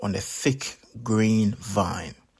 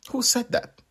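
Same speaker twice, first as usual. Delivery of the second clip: high, startled question; high-pitched startled guard; 13 semitones higher than usual. Acted. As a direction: jump in surprise.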